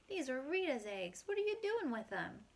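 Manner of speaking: indignant, climbing high on the question; challenging question. Acted. Old man confronting a boy over the eggs, indignant. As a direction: demand an explanation, pitch jumping up.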